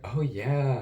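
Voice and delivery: high, loud sudden "oh yeah"; surprised realization. Retold or imitated. Friend realizing she'll be up late, surprised. She realizes, retold conversation.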